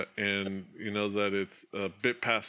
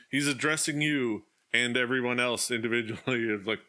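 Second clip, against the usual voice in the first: normal talking voice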